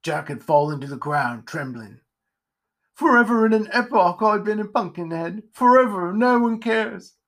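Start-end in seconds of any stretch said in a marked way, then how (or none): 2.9-7.1 high, self-pitying wail; moaning. Acted. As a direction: moan loudly in woeful self-pity. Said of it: "moaned Jack".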